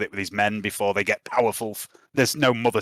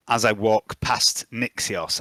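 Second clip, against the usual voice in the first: ordinary talk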